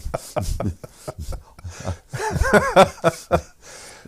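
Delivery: loud high peal of laughter; real loud laughter; long high laugh. Real, not acted. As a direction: laugh out hard at the punchline. Laughing at his own story.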